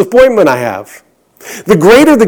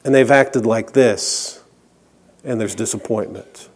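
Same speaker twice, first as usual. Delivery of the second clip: much lower and much softer, sinking; dropping to a hush; 8 semitones lower, 10 dB quieter than usual. Acted. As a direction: let the refrain fall away to almost nothing. Sermon refrain, dropping away.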